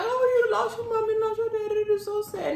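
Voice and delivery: high, exaggerated sympathy voice; mocking pity. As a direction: mimic people cooing over a sad kid. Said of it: "So they like".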